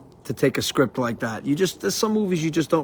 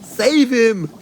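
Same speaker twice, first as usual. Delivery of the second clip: higher, louder mother's yell for help; mock panicked cry; 7 semitones higher, 4 dB louder than usual. Quoting the mother as her son gets sucked away, mock frantic cry. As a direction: shout it like a frantic mom.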